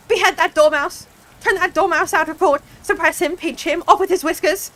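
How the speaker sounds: high, loud string of furious commands; shrieked. A narrator's reading. Queen ordering the dormouse out of court, shrieking. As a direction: keep shrieking order after order. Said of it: "the queen shrieked out".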